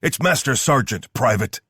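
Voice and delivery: loud, high military bark; drill-sergeant bark at a private. A narrator's reading. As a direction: rip the words out like a punch. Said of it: "Odell barked the words ripping from his mouth like a punch".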